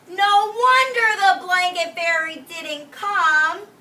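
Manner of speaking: high, loud, slow knowing remark; parents' thought. Acted. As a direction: parents seeing through the trick. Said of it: "I bet they're thinking".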